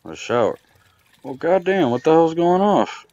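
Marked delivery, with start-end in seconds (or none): 1.2-3.1 hoch und laut aufgeschreckt; überrascht. Real, not acted. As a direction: echt erschrocken losfluchen. Water suddenly bursts from sink.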